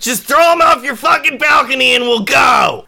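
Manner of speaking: high, full-volume shouted rant; furious yelling. Acted. Gang member outside demands they throw him off, furious shout. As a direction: bellow accusations up at a balcony. Attacker yells accusations up.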